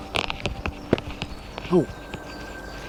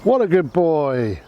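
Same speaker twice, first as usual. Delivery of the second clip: squeaky praising a dog; 5 semitones higher than usual. Real, not acted.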